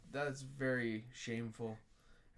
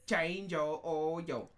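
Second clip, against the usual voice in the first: slow mock Mandarin accent; much slower than usual. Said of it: "Speak with a Mandarin accent".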